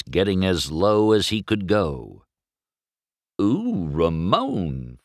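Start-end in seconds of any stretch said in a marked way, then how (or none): 3.4-5.0 slow, sultry coo to her husband. A narrator's reading. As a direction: purr his name lovingly. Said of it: "Flo cooed to her husband".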